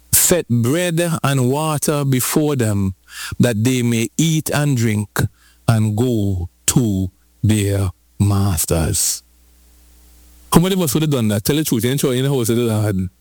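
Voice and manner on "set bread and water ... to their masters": slow gentle. Acted. Mercy voiced gently.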